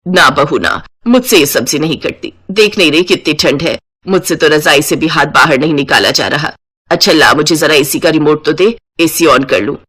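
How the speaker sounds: lightly voiced motherly mother-in-law. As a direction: greet the new bride as her mother-in-law. Mother-in-law refusing to cut vegetables.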